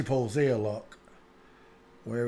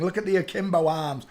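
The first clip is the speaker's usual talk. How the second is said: normal talking voice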